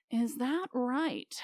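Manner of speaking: a giant's cold, simmering threat; quiet anger. Acted. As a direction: let the menace sit in the pause. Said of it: "Surely you can hear the anger in his voice".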